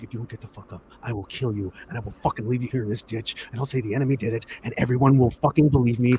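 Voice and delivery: low, hushed menace; quiet threat. Retold or imitated. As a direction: act out an officer's death threat, very softly. Officer's retold threat at gunpoint.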